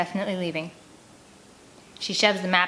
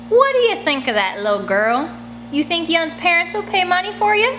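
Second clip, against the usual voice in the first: higher, louder mocking taunt; taunting sneer; 10 semitones higher, 4 dB louder than usual. Mary Jo taunts the girl up close, sneering. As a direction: get in her face and mock her.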